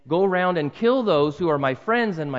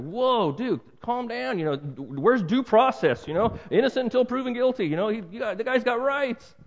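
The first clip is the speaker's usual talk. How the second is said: higher, pleading voice of a modern skeptic; mock modern objection; 7 semitones higher than usual. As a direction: play the shocked Westerner, half-mocking. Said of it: "in my Western mindset, it's like".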